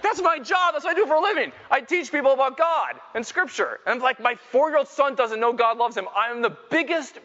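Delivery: high-pitched, hammed-up indignant rant; worked-up dad venting for laughs; comic exasperation. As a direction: protest in mock despair, voice pushed way up. Mock outrage at own parenting failure.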